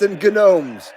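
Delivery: "gnomes" said guh-nomes with the G sounded; hard G gnomes. Shown as a demonstration.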